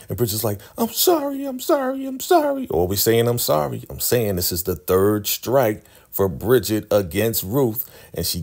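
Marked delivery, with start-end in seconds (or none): 0.8-2.7 very high squeaky mock sorry, over and over; squeaky apology. Bridget apologizes to the girls, in a very high mock squeak. 3.3-3.9 higher little mock sorry; mock apology. Bridget always apologizing, mocked with a higher sorry.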